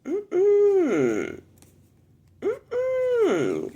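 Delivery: very high, drawn-out "mm-mm" falling away; disapproving auntie's high-pitched hum; sounding like auntie. Real, not acted. Reader channeling auntie's energy, high auntie-style "mm-mm". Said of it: "Got me sounding like my auntie".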